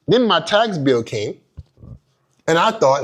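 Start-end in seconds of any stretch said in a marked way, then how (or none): none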